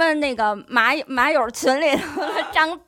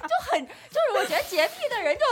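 laughing; 8 semitones higher, faster than usual. Real, not acted. Laughing comment on clean freaks.

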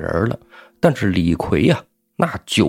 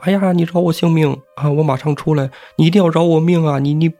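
high frightened pleading; begging for mercy; 6 semitones higher than usual. A narrator's reading. The squire's daughter begs Li Kui to spare her, high and frightened. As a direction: plead in a high pitch, gabbling in fear. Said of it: "赶紧求饶啊".

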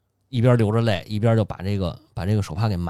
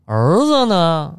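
drawn-out and louder, the father asking for his son; slow questioning father; 6 semitones higher, 6 dB louder, much slower than usual. Retold or imitated. Father home at dusk asks about missing son, slow and raised. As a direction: stretch the question out, a touch louder.

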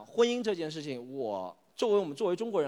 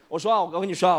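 normal talking voice

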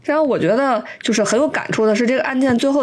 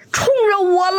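retold shout; 11 semitones higher than usual. Woman running in shouts to the falling man, high mock cry. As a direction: call out as you run in, pitched way up. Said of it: "一边冲过来还一边喊".

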